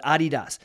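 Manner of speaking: Adidas said firmly as AH-dee-dahs; real pronunciation. Shown as a demonstration. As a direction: open first syllable on Adidas, full "dahs" ending. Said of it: "the real way to pronounce".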